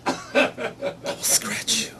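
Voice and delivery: slow, hushed effeminate threat; Catman voice. Acted. Catman threatens a suspect during interrogation, slow whispered effeminate threat. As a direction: whisper it slowly as a catty threat. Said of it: "he does sound like this".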